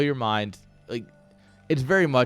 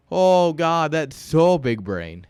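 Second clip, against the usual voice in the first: sarcastic awe; 5 semitones higher, 5 dB louder, much slower than usual. Real, not acted. Mocking players' clumsy play.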